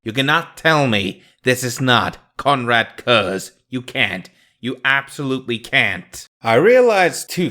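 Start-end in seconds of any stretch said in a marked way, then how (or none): none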